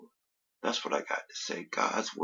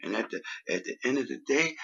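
usual speaking voice

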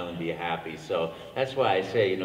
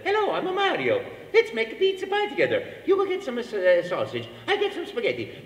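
much higher, bouncy Italian Mario; 10 semitones higher than usual. Retold or imitated. First Mario audition for the producer, high and bouncy. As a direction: chirp it out like a cheerful Italian plumber. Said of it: "and I said".

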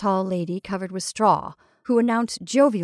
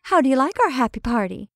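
higher, louder, cheery question; cheerful; 5 semitones higher, 5 dB louder than usual. A narrator's reading. Betty asks the camel about the party, cheerful. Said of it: "said Betty cheerfully".

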